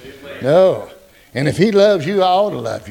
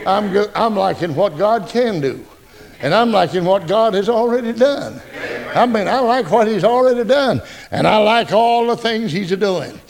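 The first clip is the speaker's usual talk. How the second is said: usual speaking voice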